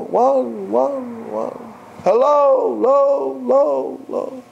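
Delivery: high calls fading away like an echo. Retold or imitated. Climber hears his own call echo back, fading. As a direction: mimic the echo dying off. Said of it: "All he hears is echo".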